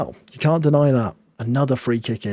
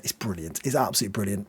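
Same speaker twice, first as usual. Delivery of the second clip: hushed delight; 4 semitones lower, 7 dB quieter, faster than usual. Real, not acted.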